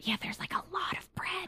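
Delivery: very soft, confiding whisper; conspiratorial whisper. Acted. Kid reveals a lot of bread, whispering. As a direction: whisper it like a juicy secret. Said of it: "we should probably whisper".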